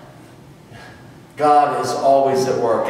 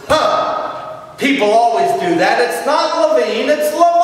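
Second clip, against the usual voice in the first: higher, indignant singer setting him straight; indignant correction; 8 semitones higher than usual. Retold or imitated. Missus [name] corrects the director, indignant and higher. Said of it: "Missus [name] looked at him and said".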